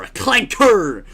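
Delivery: "clanker" slow, high and loud with a hard final R; "clanker" drawn out to a heavy southern R. Acted. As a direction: belt out "clanker", leaning on the final R. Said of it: "I said it with a hard R".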